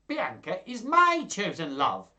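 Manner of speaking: high, loud outburst; hammed-up angry declaration; enraged cry. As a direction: cry out a jealous claim. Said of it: "turned red with rage".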